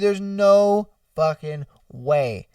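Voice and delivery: ordinary talk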